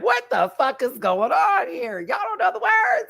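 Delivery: baffled outrage rising into a yell; exasperated disbelief. Retold or imitated. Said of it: "I'm like".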